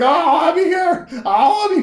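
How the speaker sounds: loud, high-pitched mock wail; imitated crying. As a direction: wail like the man crying and pleading. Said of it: "this guy's crying on it".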